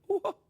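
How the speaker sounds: breathy swooning sighs; swooning women. Retold or imitated. Host mimics women swooning at Sinatra, breathy sighs. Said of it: "and women go".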